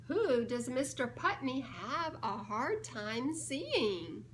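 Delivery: ordinary talk, nothing marked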